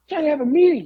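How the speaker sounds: high caricature grumble; mock New Jack. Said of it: "He's like".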